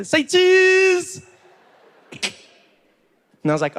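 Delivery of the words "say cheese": high-pitched, drawn-out cue for a photo; excited high holler; photographer's call. Acted. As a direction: sing out loudly to the camera subject. Fan taking a photo.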